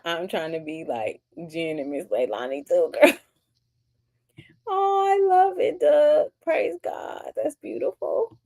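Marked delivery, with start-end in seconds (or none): 4.7-8.4 touched. Real, not acted. Moved by a chat comment.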